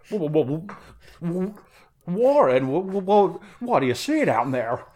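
stammering high Jimmy Stewart drawl. Acted. [name] calling down the can as Jimmy Stewart. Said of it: "a Jimmy Stewart".

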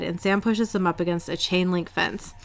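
usual speaking voice

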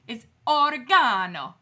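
put-on loud Italian-accented "oregano", or-e-GAH-no; hammed-up Italian accent; mock Italian accent. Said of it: "do it with an Italian accent".

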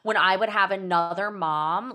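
ordinary delivery

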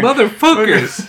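high, slow, loud play-acted curse; mock outrage. Blinded waiter watching the documentary, mock outrage. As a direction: curse like a hammed-up wronged victim. Acts blinded victim of the plot.

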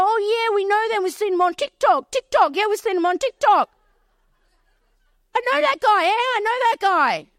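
high, eager chiming in; acted-out starstruck enthusiasm; excited recognition. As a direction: pipe up thrilled, repeating yourself. Acts the boys' excited recognition.

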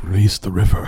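Ent voice. Retold or imitated. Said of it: "the Ents in, uh, Lord of the Rings when they're like".

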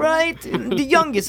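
high, insistent imitation of a lady; store lady's voice. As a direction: put on her high, amazed voice. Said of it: "she was like".

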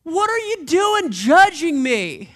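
slow, loud, high indignant yell; yelling back. Retold or imitated. Blindfolded driver shouting back at the pastor, loud indignant yell. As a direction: shout back, offended, drawn out. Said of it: "And then you yell back".

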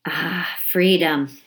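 plain reading voice

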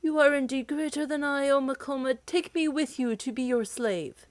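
lightly voiced giant begging his master; humble giant. Defeated giant begs Makoma.

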